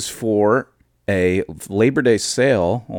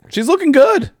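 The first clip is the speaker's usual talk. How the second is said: high-pitched gushing; excited. Real, not acted. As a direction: squeal it with delight. High squeal of approval.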